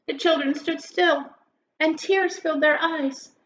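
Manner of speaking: lilting rhymed storybook read-aloud; story narration. Acted. Teacher reading a Thanksgiving poem to grade schoolers, lilting. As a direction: read it like a bedtime poem for kids.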